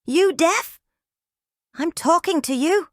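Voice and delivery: high, loud, aggressive jeer; angry taunt. Acted. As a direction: get in his face with it. Angry man confronting Roger.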